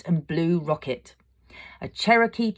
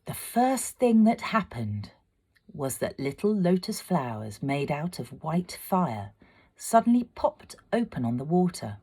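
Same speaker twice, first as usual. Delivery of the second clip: ordinary delivery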